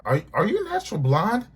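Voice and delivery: high, loud, mocking question; mocking disbelief. Real, not acted. Mocking Malaysia to her face, high and loud. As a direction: sneer it up high like she's too dumb.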